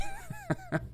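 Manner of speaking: high squealing laugh; shrieking laugh. Real, not acted. Laughing at the rumour.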